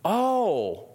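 surprised oh. Retold or imitated. Believer realizing the point.

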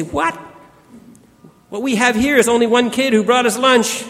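high, startled disbelief; surprised disciples. Retold or imitated. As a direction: squeak out the shocked objection. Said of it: "And they say".

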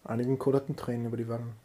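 ordinary talk

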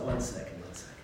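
low, soft aside fading away; said to oneself. Retold or imitated. Frustrated teacher checking himself, talking to himself. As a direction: catch yourself quietly, dropping almost to nothing. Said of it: "I said to myself".

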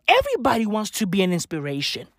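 ordinary talk, nothing marked

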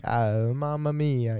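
slow, drawn-out mock Italian accent; low, stretched-out Italian exclamation. Shown as a demonstration. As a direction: put on a stock Italian accent. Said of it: "doing Italian accent".

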